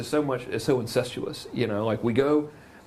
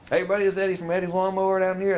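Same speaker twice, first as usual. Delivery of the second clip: higher, fast, folksy Southern Georgia TV pitch; hammed-up Southern lawnmower salesman; 7 semitones higher, faster than usual. Eddie opening his TV ad to local viewers, fast Southern twang. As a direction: play the small-town Southern mower dealer hamming it up for the camera. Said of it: "But they all talk like this".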